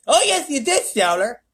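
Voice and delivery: high, loud retort; bickering. Acted. The President arguing with Showler, high loud. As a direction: insist childishly.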